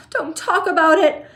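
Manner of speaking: high, loud wail. A narrator's reading. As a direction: plead through tears. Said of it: "wailed Missus T V".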